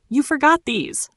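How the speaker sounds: sweet as poison; sweetly. Acted. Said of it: "I smiled sweetly".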